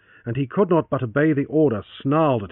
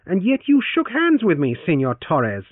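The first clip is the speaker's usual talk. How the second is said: Francis, reproachful; 7 semitones higher, 4 dB louder than usual. Acted.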